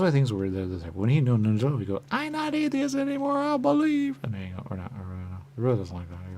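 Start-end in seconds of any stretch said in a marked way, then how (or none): 2.1-4.2 very high, loud declaration; squeaky conversion. Acted. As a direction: squeal the new belief. Quoted converted atheist.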